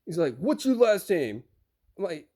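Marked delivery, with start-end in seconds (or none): 0.4-1.4 slow, pointed demand in a mock driver voice; imitated attitude. Driver demanding the receiver's last name, with imitated attitude. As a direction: imitate a pushy driver repeating himself. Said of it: "he was giving me attitude".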